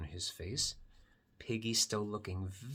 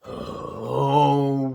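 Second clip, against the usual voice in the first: loud, drawn-out, higher "oh"; groaning "oh"; 4 semitones higher, 14 dB louder than usual. Acted. Gerald giving in to waiting, loud groaned "oh". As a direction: swell a long loud "oh".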